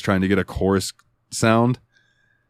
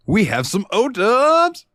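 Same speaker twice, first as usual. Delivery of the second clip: high, drawn-out hype call; big hammy fanfare for the segment; segment announcement; 14 semitones higher, slower than usual. Pretend. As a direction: stretch it out like a game-show intro. Mock-grand segment announcement.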